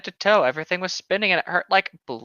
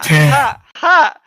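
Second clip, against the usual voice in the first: mock villain laugh; 5 semitones higher, 10 dB louder than usual.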